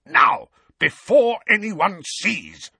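slow, high, loud urgent snarl. A narrator's reading. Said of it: "Uncle Vernon snarled into Harry's ear".

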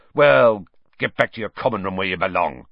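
loud start, then a fast curt command; snapped order. A narrator's reading. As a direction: snap him back to his room. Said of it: "snapped Filch".

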